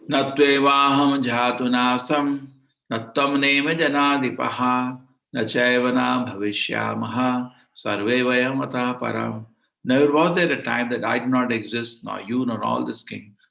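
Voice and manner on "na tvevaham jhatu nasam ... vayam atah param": slow chanted Sanskrit shloka; verse recitation. Acted. Teacher reciting the Gita verse to the class, slow chant. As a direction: intone the verse slowly, like scripture.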